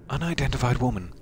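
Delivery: normal talking voice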